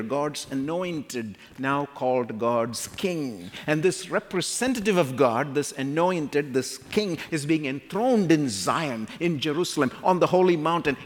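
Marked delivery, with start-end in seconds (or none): none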